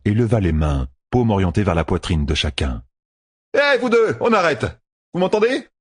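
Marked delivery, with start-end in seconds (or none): 3.6-5.7 high, urgent call to stop; calling to order. A narrator's reading. Matt steps between two opponents, calling out.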